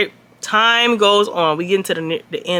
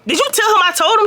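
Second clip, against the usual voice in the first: annoyed confrontation; 7 semitones higher than usual. Retold or imitated. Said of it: "I go to Haley and was like".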